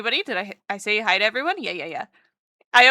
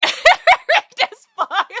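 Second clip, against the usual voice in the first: high, slow, laughing rigged as fuck; laughing accusation; 13 semitones higher, 7 dB louder, much slower than usual. Real, not acted. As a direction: drag it out through laughter.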